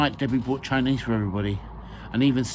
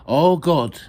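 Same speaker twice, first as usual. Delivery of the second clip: loud, raised mock-prayer call; 5 semitones higher, 9 dB louder than usual. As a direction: lead the group in exclaimed prayer. Leading ladies in mock prayer.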